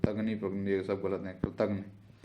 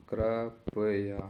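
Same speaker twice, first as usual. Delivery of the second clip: कृपया in three slow, separate syllables; very slow sounding-out; much slower than usual. Shown as a demonstration. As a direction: break कृपया into kri, pa, ya. Teacher sounds out the spelling. (कृपया को धीरे-धीरे तोड़कर)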